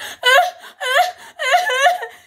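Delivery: very high, loud wailing sobs; hammed-up wordless bawling; mock crying. Answering the door to four cousins, loud mock sobbing. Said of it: "I'm crying the whole time".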